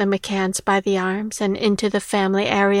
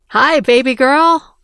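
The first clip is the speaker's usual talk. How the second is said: put-on louder, higher father's hello to his little girl; delighted greeting; 4 semitones higher, 7 dB louder than usual. A narrator's reading. Father greets daughter at a reunion, delighted. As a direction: greet her brightly and loudly, voice lifted.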